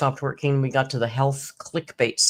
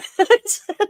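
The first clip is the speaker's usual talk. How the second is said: high laugh; 7 dB louder than usual. Real, not acted. Laugh after teller quote.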